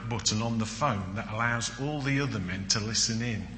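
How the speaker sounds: ordinary talk, nothing marked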